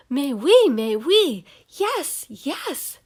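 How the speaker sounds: delighted burst of mais oui, loud and high; thrilled. Acted. Girl thrilled to get the grown-up seat, delighted gush. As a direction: gush yes at once, over the moon. Thrilled to switch to big table.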